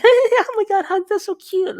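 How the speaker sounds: hammed-up cutesy girlfriend squeal. A girlfriend cooing at her man, mock cute high voice. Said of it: "they talk cute in a higher pitch".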